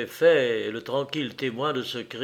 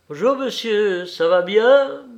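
high, loud, lively greeting; clear, raised voice of a welcoming hostess; clear-voiced woman; 7 semitones higher, 7 dB louder than usual. A narrator's reading. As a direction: call out a bright hello in a clear voice. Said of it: "une voix claire l'interrompit".

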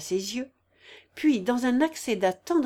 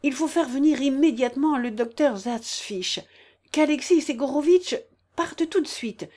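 raised, pressing orders; urgent command; 4 semitones higher, 4 dB louder than usual. A narrator's reading. General's wife sends for the doctor at once, urgent command. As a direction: issue urgent instructions, louder and higher.

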